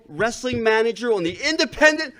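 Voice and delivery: wrestling manager. Acted. Wrestling manager promo boast.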